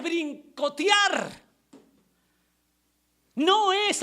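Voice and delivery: high, emphatic pulpit denunciation; full-force preaching. Real, not acted. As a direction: hammer out each denial from the pulpit. Preaches against false teaching.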